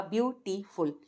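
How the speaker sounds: "ब्यूटीफुल" broken as "byu, tee, ful"; three separate parts. Shown as a demonstration. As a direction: say "ब्यूटीफुल" with a gap after each syllable. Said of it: "तीन हिस्सों में अदा होगा".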